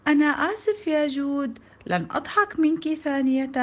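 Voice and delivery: put-on high-pitched child's apology; apologetic child. A narrator's reading. Samir promising Joud not to laugh, apologetic.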